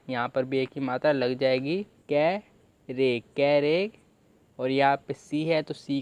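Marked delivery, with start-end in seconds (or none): 2.1-3.9 syllable by syllable. Shown as a demonstration. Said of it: "इसे हम पढ़ते हैं तोड़-तोड़ के".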